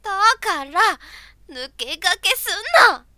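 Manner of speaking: loud, slow furious yell; angry shout. Acted. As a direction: yell it at the rival. Shouting at rival.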